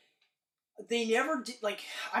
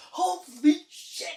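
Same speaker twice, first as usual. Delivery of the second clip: high, slow, stretched out in awe; awed holy shit; 9 semitones higher, 6 dB louder, much slower than usual. Retold or imitated. Friend recalling the theater, slow awed exclaim. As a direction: let the awe drag out. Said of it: "being like".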